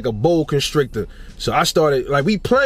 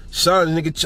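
ordinary delivery

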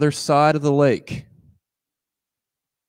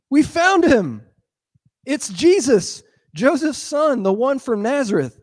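much higher, eager voice; excited announcement; 13 semitones higher, 6 dB louder than usual. Acted. Philip telling Nathanael they found the one, high and excited. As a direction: blurt out the discovery.

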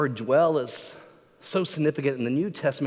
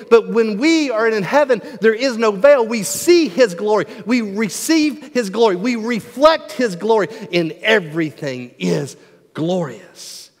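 raised, driving pulpit voice; impassioned preaching; 5 semitones higher, 6 dB louder than usual. Real, not acted. Preacher describing heaven without a veil, impassioned preaching. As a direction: build in fervor toward the glory.

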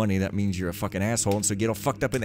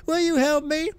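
high-pitched pitiful plea; crying beggar; 4 dB louder than usual. Acted. Beggar begging the poor novice, high pleading cry. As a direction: beg for help in a high cry. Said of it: "he cried".

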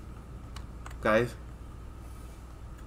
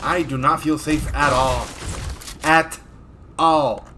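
slow "at all", each word set apart; emphatic, spaced out; slower than usual. Real, not acted. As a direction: pause between "At" and "all" for weight. Feels unsafe, repeats for emphasis.

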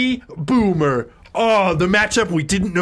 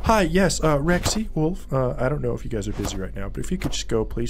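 low, soft, hesitant asking; restrained, overly polite murmur; mock-polite request; 7 semitones lower, 5 dB quieter, faster than usual. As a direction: ask meekly, trailing softer. Mock polite request before yelling.

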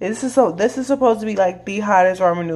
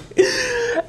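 squealing laugh, pitched way up; high cackling laugh; 3 dB quieter than usual. Real, not acted. Friend laughing at the pepper eater: high cackle.

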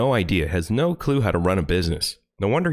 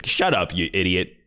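ordinary talk

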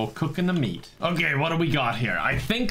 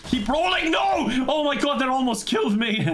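excited yelling; 11 semitones higher than usual. Real, not acted. Player shouting as a log rolls at him, high panicked yell. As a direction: yell as the log nearly hits you.

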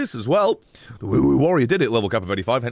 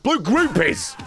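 higher, punchy exclamation; excited; 6 semitones higher, slower than usual. Shown as a demonstration. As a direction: blurt it out with glee. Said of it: "rereading the name, excited".

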